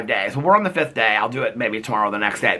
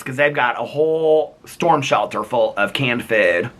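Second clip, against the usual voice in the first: normal talking voice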